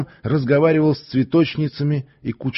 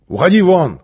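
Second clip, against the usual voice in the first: loud, higher command; shouted order; 7 semitones higher, 8 dB louder than usual. Acted. The stranger orders the strongman away with a shout. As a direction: shout him off the stage. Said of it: "крикнул негр".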